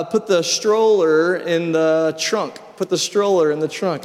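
carefully spelling it out; the host switching to American words; slow translation. Retold or imitated. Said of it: "and she said".

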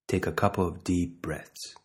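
slow, measured meditation guide with long pauses; unhurried body-scan instruction, leaving space to breathe; guided meditation. Real, not acted.

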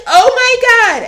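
mortified after insulting a legend; shocked oh my God. Retold or imitated. Realizing she insulted [name].

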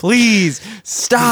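high, stretched-out begging; mock pleading. Protester begging the guy in the car, mock pleading. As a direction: plead in an exaggerated retelling. Retold plea to yelling man.

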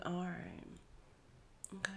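very soft, withheld acceptance; retold icy agreement under the voice; quietly miffed. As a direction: say it small and unconvinced. Retelling her cold reply to boyfriend.

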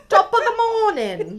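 high, loud laughing mock Irish accent. Teasing listeners abroad, a laughing mock Irish accent. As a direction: laugh through a silly stage-Irish greeting. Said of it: "We nailed that accent".

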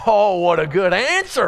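loud, high and thrilled; delighted exclamation. Real, not acted. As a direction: burst out in delight at the answer. Preacher delights in the answer.

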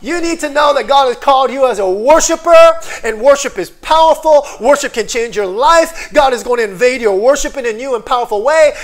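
loud, high, driving preacher voice; full-force preaching. Acted. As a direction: preach it out to the congregation.

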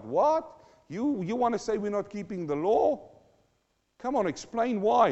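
indignant listener. Pretend. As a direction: play an affronted religious leader demanding an explanation.

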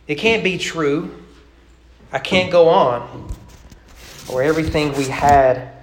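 rhythmic, lilting poem read aloud. Acted. Preacher reciting a rhyming poem to his congregation, lilting. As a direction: recite the rhyme with a storyteller's swing.

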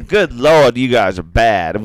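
loud, scandalized scolding; mock yelling. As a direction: imitate a boss appalled at the group. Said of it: "she was kinda yelling at us".

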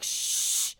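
a sharp whispered shh; shushing. Retold or imitated. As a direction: hush them with a quick shh. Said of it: "just like".

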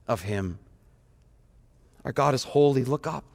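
ordinary talk, nothing marked

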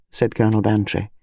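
ordinary delivery, nothing marked

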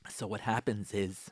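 normal talking voice

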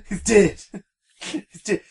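repeating it through laughter; mock the blunt line while laughing; laughing imitation. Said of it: "Please never deliver bad news to anyone".

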